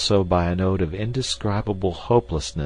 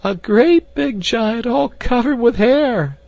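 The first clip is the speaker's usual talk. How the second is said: very high, slow wailing voice; high wailing woman; 8 dB louder, slower than usual. Acted. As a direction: wail like a terrified old maid. Voiced maid character.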